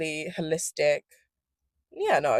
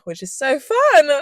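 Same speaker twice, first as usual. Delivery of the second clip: sarcastic; 8 semitones higher, 7 dB louder than usual. Real, not acted. Sarcastic aside about switching moods.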